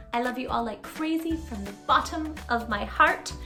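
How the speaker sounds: ordinary delivery, nothing marked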